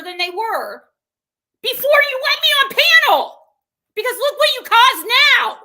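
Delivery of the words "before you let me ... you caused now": much louder, higher and fast; shouting at Purple, furious; angry outburst. Real, not acted. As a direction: blast it out in frustration. Raging about being kept off the panel.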